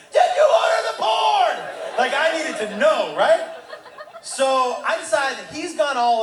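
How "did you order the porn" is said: high, loud courtroom demand; Tom Cruise. Acted. Comedian grilling his son like A Few Good Men, dramatic shout. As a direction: shout it like a dramatic cross-examination. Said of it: "I've become Tom Cruise from A Few Good Men".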